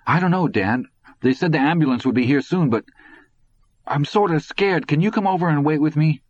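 high-pitched, anxious plea to Dad; scared boy. A narrator's reading. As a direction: plead like a worried kid on the phone. Frightened boy phones his father.

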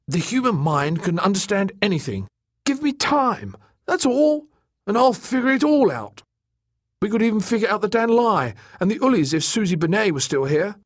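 high, agitated character voice; stubborn mapmaker, defiant. Acted. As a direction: insist optimistically in a high-pitched voice.

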